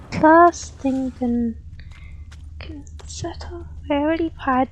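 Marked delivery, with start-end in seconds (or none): 2.6-3.7 unvoiced whisper to herself. Real, not acted. Player whispering to herself while searching the map. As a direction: breathe the words out, thinking aloud.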